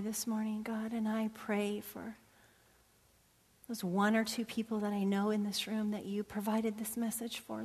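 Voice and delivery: closing congregational prayer. Acted. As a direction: pray quietly and intimately.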